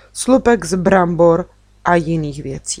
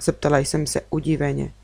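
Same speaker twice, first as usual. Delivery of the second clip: usual speaking voice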